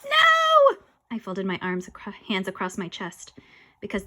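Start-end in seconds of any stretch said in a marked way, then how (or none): 0.0-0.8 very high, loud yell; defiant refusal. Acted. Boy refuses to follow the ghost, defiant yell.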